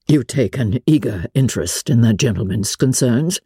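plain reading voice

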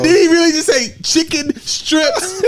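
high, slow words through laughter; can't believe he said it, laughing; cracking up in disbelief. Real, not acted.

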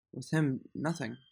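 choked up, trailing off; getting emotional. Real, not acted. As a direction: voice breaking into near silence. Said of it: "I'm sorry, I'm getting emotional".

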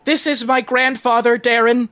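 high, enthusiastic guide voice; eager tour guide. Acted. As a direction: greet visitors in a high, over-eager pitch. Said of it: "my name is Rago".

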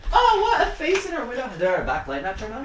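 high, hushed startled questions; hushed alarm. Real, not acted. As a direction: ask softly, suddenly spooked. Someone saw a face outside.

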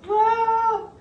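high, unsure wife voice, imitated; hesitant wife. Husband imitates his wife hesitating over the couches, high and unsure. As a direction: do the wife wavering at the counter. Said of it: "Heather in that moment goes".

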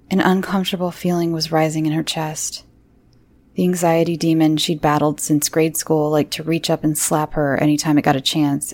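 plain reading voice

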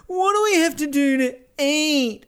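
high, slow whining Amber; whiny Amber quote. Retold or imitated. Retelling Amber's famous line to his co-host, high slow whine. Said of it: "famous sort of line".

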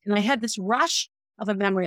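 usual speaking voice

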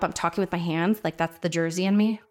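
ordinary talk, nothing marked

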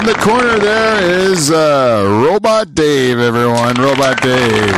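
big booming announcer intro; ring announcer. Acted. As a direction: announce him like a boxing MC. Host announcing team like ring announcer.

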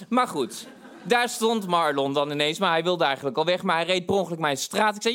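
ordinary delivery

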